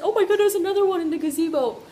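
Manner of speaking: high, loud, fast gush about a cute guy; excited gossip. Retold or imitated. Friend spots another cute guy in the gazebo, fast excited gush. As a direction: gush it out quickly. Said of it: "and then she said".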